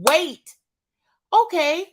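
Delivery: ordinary talk, nothing marked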